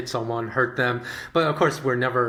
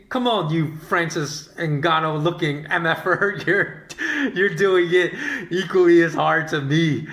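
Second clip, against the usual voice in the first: laughing indignation; 6 semitones higher, 4 dB louder than usual. Retold or imitated. Inner retort while laughing.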